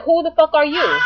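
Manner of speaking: high-pitched indignant challenge; worked-up, incredulous retort, pitched high; retold cursing out. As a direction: retell it as an outraged comeback. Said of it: "I just went crazy on this guy".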